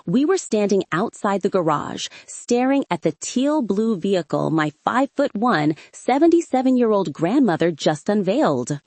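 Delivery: usual speaking voice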